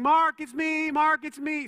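loud pretend call at the door. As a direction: call out so the neighbor knows it's you. Calling through door at midnight.

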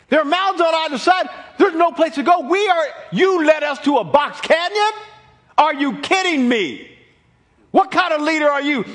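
high, loud, frantic yelling at Moses; panicked shouting. Acted. Israelites yell at Moses with Pharaoh's army coming: loud panicked shouting. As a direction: shout it like a scared mob blaming its leader. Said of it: "they were going".

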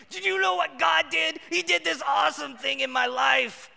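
high, loud gushing about God; hammed-up thrilled testimony; excited boast. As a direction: brag to the neighbor with big excitement. Excited neighbor call, retold.

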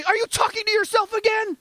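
imitated wife calling out in high pitch; wife's voice. Wife questioning her grumbling husband, high imitated voice. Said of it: "my wife would say".

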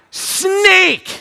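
sharp, loud, high blurt; urgent blurt. Retold or imitated. Father answering his son's why, loud alarmed blurt. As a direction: spit the word out in alarm.

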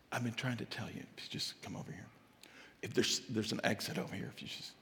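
God's hushed, low near-whisper; barely audible. Acted. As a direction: murmur it so they must strain to hear. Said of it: "Now, you can't hear me".

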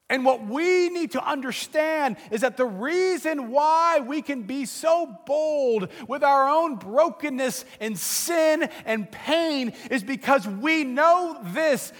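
normal talking voice

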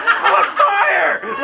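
high, slow laughing yell; mock yell. Man being prayed for, panicking, high laughing yell. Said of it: "he literally yelled this out".

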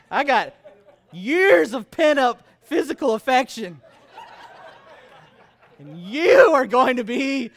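hammed-up eager husband, laughing as he yells; excited shout. Preacher retells wooing his wife, laughing shout. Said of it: "And I'm like".

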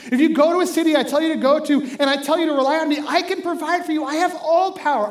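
high, loud, impassioned rallying speech; Jesus. Retold or imitated. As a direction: preach at full force, urgent and exultant. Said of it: "Jesus is saying".

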